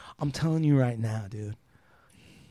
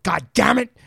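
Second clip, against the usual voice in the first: louder, higher mock curse of an angry father; angry dad curse; 7 semitones higher, 7 dB louder than usual. Son imitates his father's angry curse, louder and higher. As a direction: spit it out like a dad losing his temper. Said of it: "then he'd be like".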